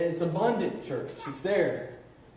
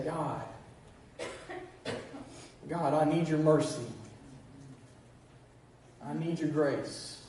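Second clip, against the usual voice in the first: humble prayer; 4 semitones lower, 7 dB quieter than usual. Retold or imitated. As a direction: plead quietly for mercy. Quoted humble prayer to God.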